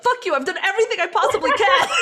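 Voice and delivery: loud, high laughing mock shout; mock angry outburst. At an employee on the fifth try, laughing mock outburst. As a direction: blurt it out, laughing at yourself. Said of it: "in your brain, you're like".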